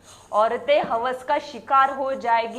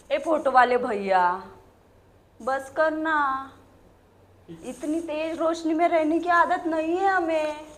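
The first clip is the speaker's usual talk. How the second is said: raised, higher call across a hall; 5 semitones higher, 3 dB louder than usual. Acted. Telling the photographer to stop, calling out. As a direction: call him off from the stage.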